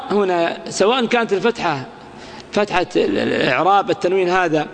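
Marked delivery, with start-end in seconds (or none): none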